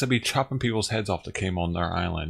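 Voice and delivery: ordinary delivery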